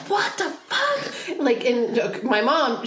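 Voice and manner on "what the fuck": high, very slow disbelieving mom; shocked mom. Retold or imitated. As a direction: stretch it out in shock as the mother. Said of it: "She's like".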